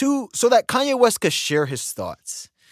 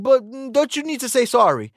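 mocking Piers Morgan; 7 semitones higher than usual. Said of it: "He's like".

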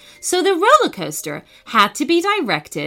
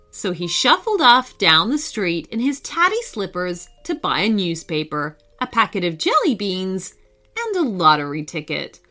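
plain reading voice

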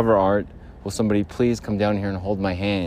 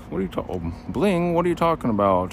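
lightly higher voice for the beer can; 6 semitones higher than usual. Acted. Talking can answering its owner, higher pitched. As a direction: give the can a little voice of its own.

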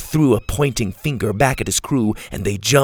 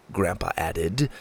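plain reading voice

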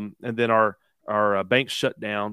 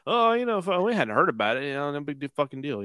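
higher-pitched, dismissive mock shrug; mock nonchalance; 4 semitones higher than usual. Podcaster imitating an unimpressed public, mock nonchalance. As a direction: act out people brushing it off. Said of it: "and we would be like".

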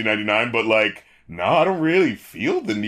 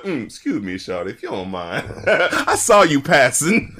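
smooth rapper voice. Retold or imitated. Reviewer acting the verse's cool opening. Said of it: "the way he starts it".

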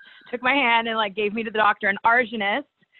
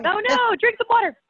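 a much higher, caring urge to the coughing host; urging her kindly to drink, pitched up; real concern; 12 semitones higher than usual. Real, not acted.

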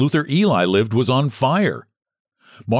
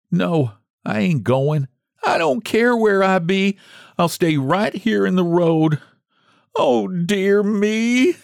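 wailing; 11 semitones higher, slower than usual. Acted.

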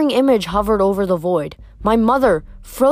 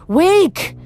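high, loud ghostly call; urgent spirit voices; overlapping graveyard whispers; 9 semitones higher, 3 dB louder than usual. Acted. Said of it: "the dead whispered".

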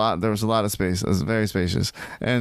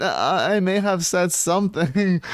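innocent excuse; 6 semitones higher than usual. Retold or imitated. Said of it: "she's like".

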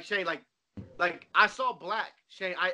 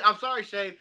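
ordinary talk

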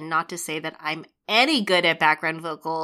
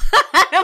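high burst of laughter; 13 semitones higher, 13 dB louder than usual. Real, not acted. Laughs at sad little journal.

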